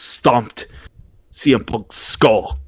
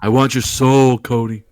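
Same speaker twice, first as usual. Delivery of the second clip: The Rock impression. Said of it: "The Rock".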